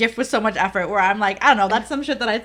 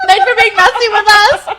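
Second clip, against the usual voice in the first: laughing thanks; 9 semitones higher, 10 dB louder than usual. Real, not acted. Cheerful sign-off.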